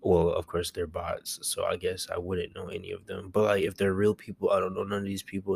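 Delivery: usual speaking voice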